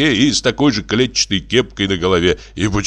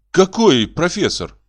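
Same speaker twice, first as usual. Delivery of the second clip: confused Nyura; 10 semitones higher than usual. Acted. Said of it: "не менее конфузливо спросила Нюра".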